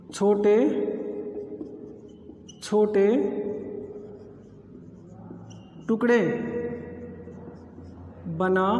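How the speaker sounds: word by word, long pauses between words. Real, not acted. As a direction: dictate it haltingly, as if writing each word out. Dictating while writing out the definition.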